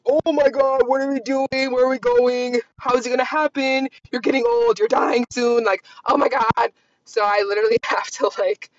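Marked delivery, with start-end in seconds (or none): 0.0-6.7 lightly higher, panicky rush of worries; a touch of mock panic, higher; spazzing inner child. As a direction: play your freaked-out younger self. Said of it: "my inner child is, like, spazzing".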